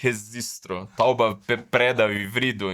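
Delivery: "registro" as rezistro, then "tauba", "preda", "vrido" with sounds swapped; popular mispronunciation. Shown as a demonstration. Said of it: "uma pronúncia assim". ("registro" dito "rezistro", e "tauba, preda, vrido")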